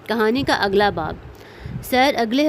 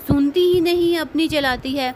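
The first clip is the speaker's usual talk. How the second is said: exasperated scolding; 6 semitones higher than usual. A narrator's reading. Mother scolding her daughter.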